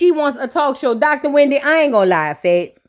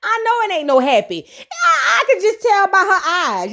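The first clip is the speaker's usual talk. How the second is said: normal talking voice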